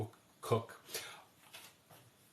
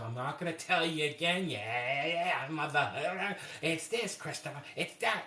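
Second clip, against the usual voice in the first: a lightly voiced exasperated aunt, higher; mock her hammed-up impatience; overdramatic aunt; 5 semitones higher than usual. Aunt refusing to repeat her recipe, mildly overdramatic. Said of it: "be so overdramatic".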